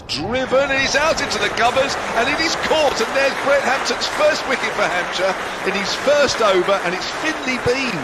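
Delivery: rising, loud, fast-building commentary; excited wicket call. Real, not acted. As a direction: call the catch as it happens, thrilled. Wicket falls, commentator calls it.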